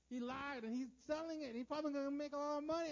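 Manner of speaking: high, agitated inner protest; hammed-up aggrieved ranting; worked-up indignation. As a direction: complain in rising pitch, acted. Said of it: "I can feel my flesh rising".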